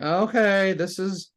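retold unease, pitched well up; high, mock freaked-out okay; freaking out. Reacting to the man's message from God, imitated freak-out. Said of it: "freaking out here".

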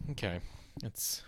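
ordinary talk

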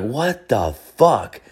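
slow, drawn-out what the fuck; retelling his stunned reaction to the giant bottle; stunned disbelief. Retold or imitated. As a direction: let it hang in slow disbelief.

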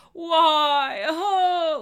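high, loud mock wail of self-pity; victim wail. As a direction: ham up the poor-me cry. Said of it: "a victim archetypal quality".

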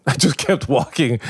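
laughing. Real, not acted. Laughing as he retells his desert walk. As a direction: chuckle through the words. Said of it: "Forrest Gump at Burning Man".

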